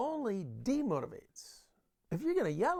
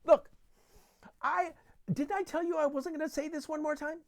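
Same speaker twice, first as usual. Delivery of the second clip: high, raised scolding voice; exasperated parent; 10 semitones higher, 6 dB louder than usual. Acted. Parent scolding a child who won't clean up, exasperated. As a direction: scold a child who has ignored you.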